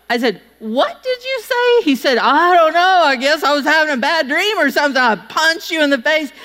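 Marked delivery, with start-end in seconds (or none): 0.6-1.8 much higher, slow disbelief; shocked high what. Retold or imitated. Wife hearing she was punched, high and slow in disbelief. 2.2-6.3 casual husband. Retold or imitated.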